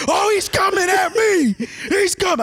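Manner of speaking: loud, high, hyped yell; retold scream. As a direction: holler like a battle crowd hype man. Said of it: "they were just screaming".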